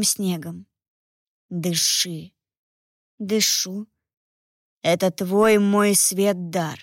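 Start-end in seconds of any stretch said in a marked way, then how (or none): 1.5-6.8 low, distant voice in the head; inner voice without words. A narrator's reading. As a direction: speak it like a voice inside the mind. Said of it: "Эфферат говорил голосом, но без слов".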